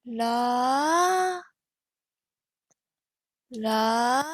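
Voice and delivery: la sliding between pitches; joined pitch demo. Shown as a demonstration. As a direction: link the two notes on la. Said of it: "join it together".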